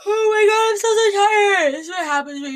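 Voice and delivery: high, loud, whiny exhausted wail; exhausted whine. Real, not acted. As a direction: wail how tired you are. Exhausted outburst mid-topic.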